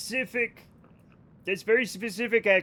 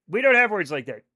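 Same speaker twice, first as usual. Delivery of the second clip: normal talking voice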